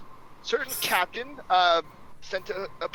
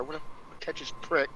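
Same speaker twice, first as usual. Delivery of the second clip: softer, low grumble to himself; low muttering; 2 semitones lower, 5 dB quieter than usual. Acted. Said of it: "in a low tone".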